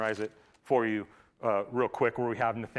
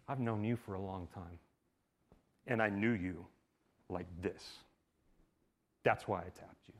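tender; 5 semitones lower, 8 dB quieter than usual. Retold or imitated. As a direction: say it gently, up close. Jesus speaking intimately.

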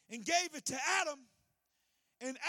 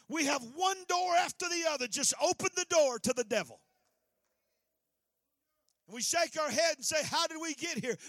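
rising preaching; 2 semitones higher, 5 dB louder than usual. Real, not acted. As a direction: build the sermon to a peak. Preaching climax.

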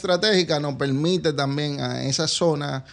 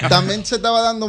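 higher and louder, laughing; 6 semitones higher, 6 dB louder than usual. Real, not acted. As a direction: chuckle while answering. Joke about the toll booth.

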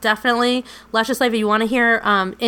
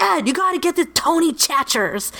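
put-on a friend's strong Michigan accent, pitched higher; Jake's Michigan accent; 6 semitones higher than usual. Imitating Jake scolding Ed, in a Michigan accent. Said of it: "with his awesome Michigan accent".

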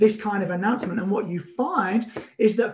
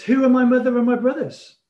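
ringing rhetorical question; 4 semitones higher, 5 dB louder than usual. Retold or imitated. Jesus questions the crowd.

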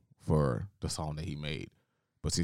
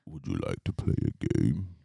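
Saw villain line; 2 semitones lower than usual. Retold or imitated. To a friend, the Saw villain's creepy invitation. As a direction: invite them to a deadly game. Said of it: "what's the saying that he does".

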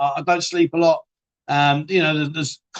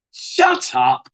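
high, sudden cry of disbelief; mock outraged; 13 semitones higher than usual. Real, not acted. Host scoffing at a viewer's worry, high and sudden. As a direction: squeal it out as a jokey protest.

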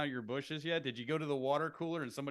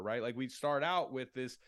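usual speaking voice

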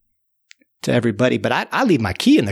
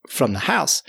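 ordinary delivery

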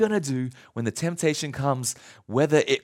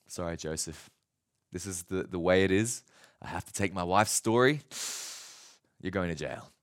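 stern master; 12 semitones lower, 7 dB quieter than usual. Retold or imitated. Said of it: "But Potiphar says".

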